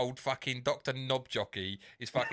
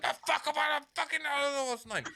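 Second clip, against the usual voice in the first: higher, irritated brush-off; annoyed brush-off; 13 semitones higher than usual. Retold or imitated. Retelling the sketch, Key brushes him off in a higher voice. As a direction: push the stranger away. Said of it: "And he's like".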